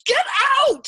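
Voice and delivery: loud, frantic shout; hysterical yell. A narrator's reading. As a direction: yell it hysterically to drive him off. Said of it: "Yossarian yelled hysterically".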